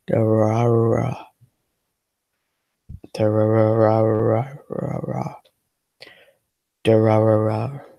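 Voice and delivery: Durarara drawn out slowly with rolled r's. Shown as a demonstration. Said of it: "I know what'll make you happy".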